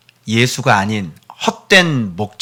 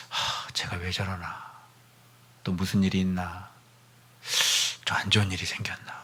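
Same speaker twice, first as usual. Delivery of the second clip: worried murmur; 7 semitones lower, 15 dB quieter than usual. Acted. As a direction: fret to yourself under your breath. Parent worried inner thought.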